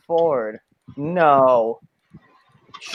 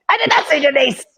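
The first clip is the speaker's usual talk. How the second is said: much higher, exaggerated indignant squawk; mocking angry denial; 10 semitones higher, faster than usual. Mimicking a friend's denial the way his mother mimics angry people, high and shrill. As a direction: parrot his denial as an overblown rant.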